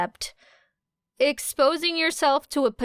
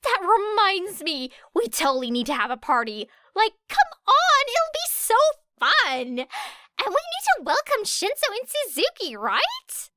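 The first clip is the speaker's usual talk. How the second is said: high, loud and bubbly; excited Ashido; 11 semitones higher, 3 dB louder than usual. A narrator's reading. Ashido pitching a party to the class, high and excited. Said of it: "clapped her hands together excitedly".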